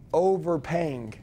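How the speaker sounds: "overpaying" said slowly on its own; stress on overpaying. Shown as a demonstration. Coach repeating the script line, drawn-out emphasis on "overpaying". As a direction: lean on "overpaying", stretching it out. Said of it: "really emphasize that word".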